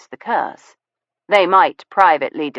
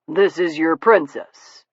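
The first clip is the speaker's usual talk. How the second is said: gentleman's voice. A narrator's reading. Steele slow musing.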